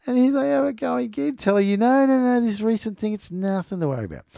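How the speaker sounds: mock the soothing official line. Mocking the officials telling viewers not to worry, high and sarcastic.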